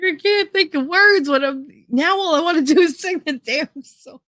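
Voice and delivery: high-pitched, wailing complaint; mock exasperation. Friend complaining the song is stuck in her head, exasperated.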